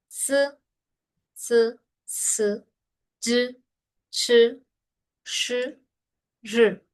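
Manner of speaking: exaggerated short buzzing "s", "sh", "r"; short Chinese i. Shown as a demonstration. Teacher exaggerating the short i so students catch it. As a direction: clip the vowel short after the consonant. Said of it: "la I mía es muy corta".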